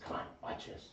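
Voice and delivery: whispered, sneaky aside to his brother; conspiratorial whisper. Retold or imitated. Whispering to Rock before scaring Sean, sneaky whisper. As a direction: hush it like you're plotting a prank.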